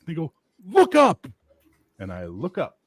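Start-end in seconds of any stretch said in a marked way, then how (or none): 0.7-1.3 high, loud two-word call; urgent warning shout. Retold or imitated. Coworkers warning the brawling bouncer, urgent shout.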